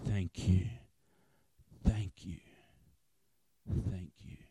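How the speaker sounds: loud, breathy, halting thanks; imitating dying man's gratitude. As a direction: push each thanks out on heavy breath.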